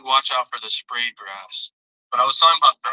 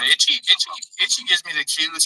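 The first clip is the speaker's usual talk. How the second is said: normal talking voice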